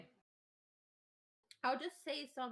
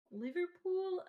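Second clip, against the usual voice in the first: higher, badly faked Scouse; attempted Scouse accent; 8 semitones higher, 4 dB louder, slower than usual. Shown as a demonstration. Trying how Liverpool natives say it, failed Scouse. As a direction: try a Scouse accent and miss. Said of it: "Okay, I can't do that".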